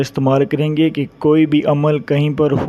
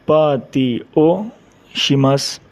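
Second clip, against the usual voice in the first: slow demo; much slower than usual. Shown as a demonstration. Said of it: "teacher models phrase slowly".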